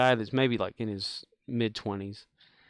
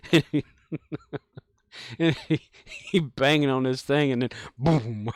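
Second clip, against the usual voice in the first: laughing; 2 semitones higher, 4 dB louder than usual. Retold or imitated. Mom's words retold laughing.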